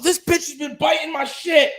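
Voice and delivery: sudden high-pitched indignation; exasperated outburst. Real, not acted. Owner discovering the pet chewed things, exasperated outburst. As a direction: break off in real outrage.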